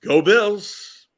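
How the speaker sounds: upbeat Go Bills cheer. Shown as a demonstration. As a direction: cheer it out for the team. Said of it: "All right, we're ready".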